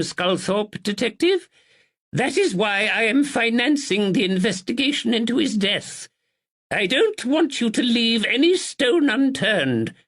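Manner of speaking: put-on high, stately old lady; elderly lady voice. Narrator voices Lady Anne.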